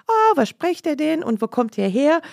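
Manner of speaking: high, loud curious question; mock the cheerful shop owner asking; the Greek shopkeeper. Imitating the Greek at the counter, high and curious. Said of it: "Und der Grieche fragt".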